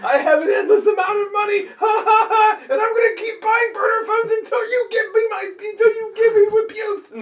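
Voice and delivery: high, slowed shouting through laughter; maniacal prank caller. Pretend. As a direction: gloat like a cartoon villain, cackling. Listener joins in as prank caller.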